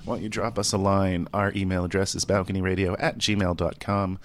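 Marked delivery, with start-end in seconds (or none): none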